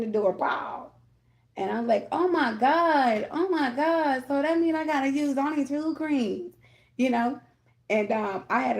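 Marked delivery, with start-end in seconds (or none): 2.1-6.5 high, whiny pouting voice, sliding down. Retold or imitated. Retelling her pouting over the last coffee, high and whiny. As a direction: whine like a sulky child about the coffee. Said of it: "I was pouting".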